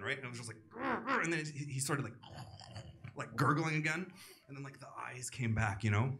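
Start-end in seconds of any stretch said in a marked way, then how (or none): 0.7-1.2 high strained growling noise; choking growl. Retold or imitated. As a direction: imitate a choking man's grunts. Imitating choking man's noise.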